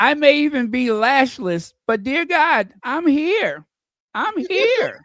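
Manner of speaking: high-pitched, hammed-up defiant declaration; Victoria Arbiter's parting words. Co-host acts out a fired pundit's parting words, mock-defiant. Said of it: "Victoria Arbiter's parting words".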